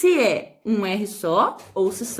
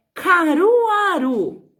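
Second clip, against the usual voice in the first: slow, loudened dictation with drawn-out syllables; ditado pausado; 6 semitones higher, 4 dB louder, much slower than usual. Shown as a demonstration. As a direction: dictate the town's name slowly, pitched up. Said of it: "quarta e última palavra". (ditado lento, com sílabas alongadas)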